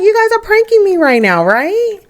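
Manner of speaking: high, incredulous mom voice; high-pitched doubting question; acted disbelief. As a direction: ask it way up high, hoping it's a joke. Mom disbelieves pregnancy news.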